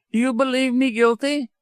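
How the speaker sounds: high-pitched, indignant widow; agitated cry. A narrator's reading. Widow confronts doctor on her guilt, agitated cry. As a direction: demand an answer in a high, strained cry. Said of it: "cried the widow, clenching her fists".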